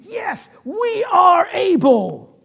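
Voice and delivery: loud, high, drawn-out declaration; enthusiastic confidence. Retold or imitated. Disciples assuring Jesus they are able, boldly enthusiastic. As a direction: proclaim it slowly with total confidence. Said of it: "their enthusiasm was such".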